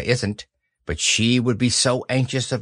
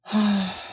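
very long sigh; 8 semitones higher than usual. A narrator's reading. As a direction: let out a long lonely sigh. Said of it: "gave a very long sigh and said".

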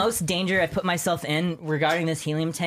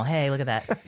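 ordinary talk

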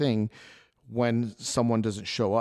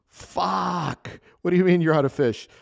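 a burst of disbelief, louder and higher; exasperated shout; 7 semitones higher, 6 dB louder than usual. Retold or imitated. Chef yelling at the cook who just ran out of fish, exasperated. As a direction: yell it, stunned and furious, at the line cook.

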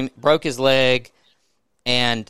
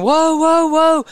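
high, loud panicked whoa; retold scream; 14 semitones higher, 6 dB louder, slower than usual. Rider behind on the trail screaming as horses fall, high panicked yell. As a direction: yell it like a rider whose horse is going over. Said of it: "the guy behind me screaming".

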